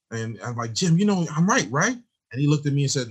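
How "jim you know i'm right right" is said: high, pleading "I'm right, right?"; pleading for agreement. Retold or imitated. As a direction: voice jumps up, seeking approval. Seeking agreement from Jim.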